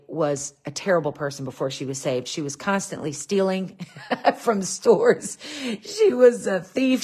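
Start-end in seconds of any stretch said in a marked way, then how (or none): 4.1-7.0 chuckling through the words; amused, half-laughing confession. Real, not acted. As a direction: laugh while admitting it. Laughing about mom's thieving past.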